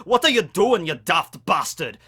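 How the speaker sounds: Scottish accent, jokey, full-voiced insult. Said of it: "That's the best Scottish I got".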